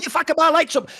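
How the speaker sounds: hammed-up on-set meltdown at a crew member; imitating a tantrum. Imitating an actor's on-set tantrum, mock furious.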